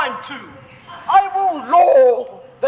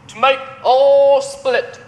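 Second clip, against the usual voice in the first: ordinary talk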